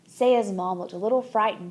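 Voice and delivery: ordinary delivery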